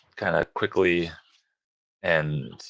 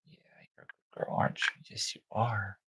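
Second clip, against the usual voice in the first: soft, fast, breathy whisper to a puppy; 8 dB quieter, faster than usual. Real, not acted. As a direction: whisper praise to the newborn. Cooing to newborn puppy.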